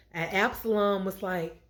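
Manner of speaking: ordinary delivery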